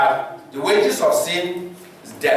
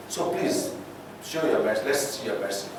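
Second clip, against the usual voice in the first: soft, whispered plea, much quieter; pleading softly; 8 semitones lower, 9 dB quieter than usual. Retold or imitated. Moses pleading with God, soft and whispered. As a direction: beg gently for mercy, almost a whisper.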